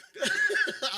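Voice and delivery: loud, high laugh bursting out; real high laugh after the tease; high burst of laughter. Real, not acted. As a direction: crack up hard.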